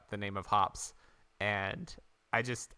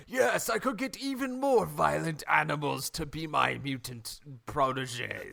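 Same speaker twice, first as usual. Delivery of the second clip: sneering panto villain; 3 semitones higher than usual. Retold or imitated. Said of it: "and he's like".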